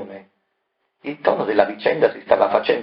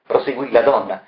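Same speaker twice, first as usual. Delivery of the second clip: usual speaking voice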